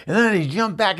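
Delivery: usual speaking voice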